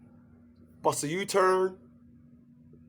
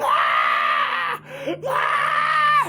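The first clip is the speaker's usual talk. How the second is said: mock scream of pain. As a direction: howl like someone just stabbed. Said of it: "they be yelling".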